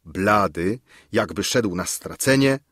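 plain reading voice

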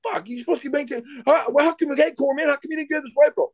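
high-pitched exasperated rant; mock exasperation. Staff sergeant scolding the corpsman over a rifle, mock exasperation. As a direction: imitate a fed-up sergeant, pitch way up.

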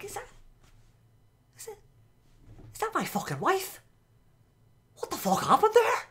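put-on shocked husband in a high Belfast accent; stunned Belfast husband, voice jumping up in disbelief. Husband watching his wife on TV, in a Belfast accent. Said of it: "I'll be in that accent as well, 'cause he is from Belfast".

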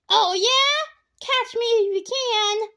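high, loud cocky taunt. A narrator's reading. Bradley taunting the girls before speeding off, cocky. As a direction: dare them to chase you.

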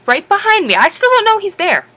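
ordinary talk

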